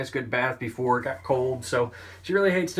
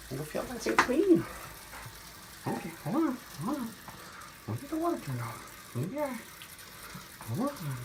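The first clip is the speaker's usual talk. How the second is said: soothing a scared cat; 11 semitones higher, 6 dB quieter than usual. Real, not acted. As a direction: coax a frightened cat gently. Calming cat in the bath.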